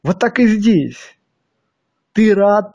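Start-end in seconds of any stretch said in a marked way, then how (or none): none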